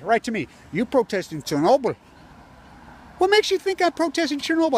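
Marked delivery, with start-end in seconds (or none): 0.7-1.9 a touch of the guard's voice; mock the guard's question; lightly voiced security guard; imitating the guard. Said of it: "he says right to me". 3.2-4.8 high, loud indignant outburst; much higher and louder angry reply; indignant retort. Retold or imitated. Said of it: "I said".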